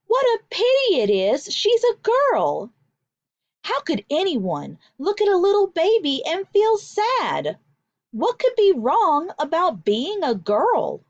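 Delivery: put-on high, pitying lament over a baby girl; pitying visitor. A narrator's reading. Visiting woman pities the new baby girl, high lament. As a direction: sigh it like a woman sorry for the baby.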